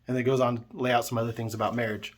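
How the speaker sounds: ordinary delivery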